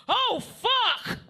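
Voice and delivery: high loud yelp of alarm; mock last cry. His last words blacking out in ambulance, mock yelp. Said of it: "My last words I remember saying".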